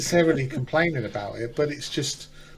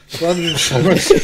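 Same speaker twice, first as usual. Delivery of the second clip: louder, laughing restart; 3 semitones higher, 7 dB louder than usual. Real, not acted. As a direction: laugh the line out again. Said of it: "oh, sorry, I'm burping".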